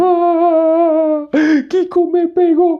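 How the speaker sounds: very high, loud mock bawling; la Chilindrina crying. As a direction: wail it slowly like a comic crying child. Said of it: "Y ella llorando". (llanto chillón fingido de niña)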